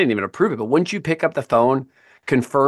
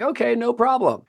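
breezy mock agreement; 10 semitones higher, 3 dB quieter than usual. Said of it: "This was not me".

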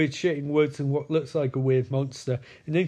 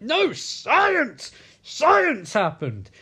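high, stretched-out proclamation; hammed-up outburst of insistence; mock insistent yell; 9 semitones higher, 4 dB louder, much slower than usual. Acted quarrel, Caleb insisting.

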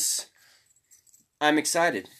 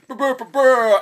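silly brr noise; 10 semitones higher, 8 dB louder than usual. Acted.

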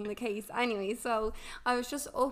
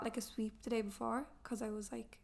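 half-whispered, a little embarrassed; whispered aside; 7 dB quieter than usual. Real, not acted. Whispering the embarrassing detail to her friend. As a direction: drop to a whisper for the awkward bit.

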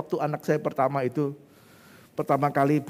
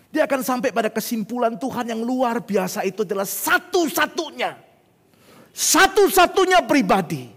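high, emphatic proclamation growing louder; full-force preaching; 9 semitones higher, 8 dB louder than usual. Real, not acted. Preacher proclaiming God as the only helper, high and loud. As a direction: build to a loud, stretched-out climax.

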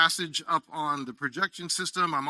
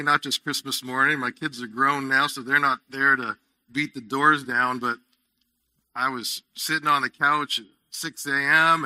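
normal talking voice

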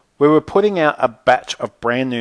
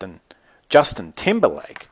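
ordinary delivery

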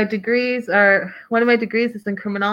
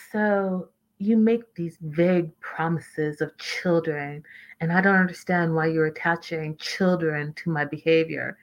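deliberate and creepy; 4 semitones lower, 5 dB quieter than usual. Retold or imitated. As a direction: imitate his measured, creepy cadence. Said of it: "very deliberate and it's kind of creepy".

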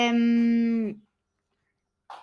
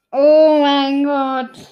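mock shock; 10 dB louder, much slower than usual. Real, not acted. Kids reacting to a bad joke.

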